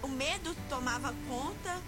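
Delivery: ordinary talk, nothing marked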